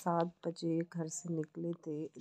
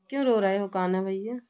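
ordinary delivery

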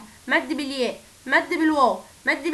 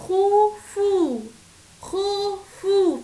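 خوفو higher and very slow, oo held long; slow stretched reading; 6 semitones higher, much slower than usual. Shown as a demonstration. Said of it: "كلمة".

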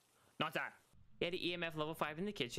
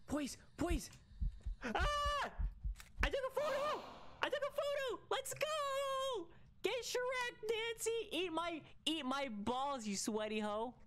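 real screaming triumph at the photo; ecstatic scream; slower than usual. Real, not acted. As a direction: yell out the win in pure excitement. Excited after catching the photo.

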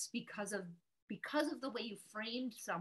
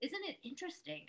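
curious reframe; 10 semitones higher, faster than usual. Shown as a demonstration. Models curiosity instead of judgement.

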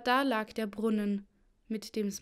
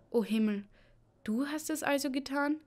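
raised pitch on a dismayed question; aghast, climbing higher into disbelief; horrified; 4 semitones higher than usual. A narrator's reading. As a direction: gasp out an appalled realisation. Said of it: "fragte sie entsetzt".

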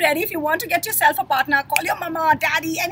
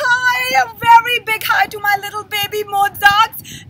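excited greeting; 8 semitones higher, 7 dB louder than usual. Real, not acted. Greeting kids on video.

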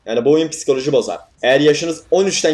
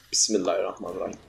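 murmured prayer; 7 semitones lower, 10 dB quieter, faster than usual. Real, not acted.

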